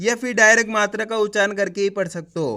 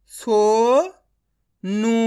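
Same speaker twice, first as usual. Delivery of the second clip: Sonu stretched very slowly, ओ held long; drawn-out reading; much slower than usual. Shown as a demonstration. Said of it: "direct मात्रा का उच्चारण करके पढ़ेंगे".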